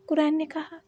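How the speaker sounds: ordinary delivery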